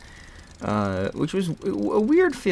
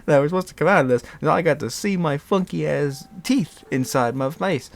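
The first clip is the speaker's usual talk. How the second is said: whiny; 6 semitones higher than usual. Real, not acted. Whining about the lost teeth.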